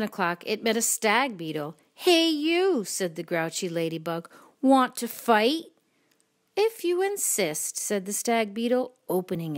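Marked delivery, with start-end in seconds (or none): 2.0-2.8 high, loud storybook call-out; grouchy challenge. A narrator's reading. Grouchy ladybug accosting a stranger, high loud challenge. 4.6-5.7 loud, slow, rising challenge; grouchy challenge. A narrator's reading. Grouchy ladybug picking a fight, loud rising challenge. 6.6-7.8 high, lilting storybook reply; obliging reply. A narrator's reading. Creature accepting the ladybug's challenge, high and lilting.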